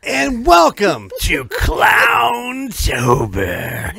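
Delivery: loud, slow, high drawn-out yell; shouted show intro. Acted. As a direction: stretch out the welcome, shouting.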